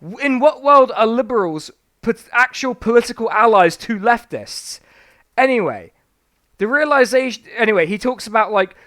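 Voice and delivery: ordinary talk, nothing marked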